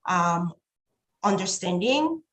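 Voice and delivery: ordinary delivery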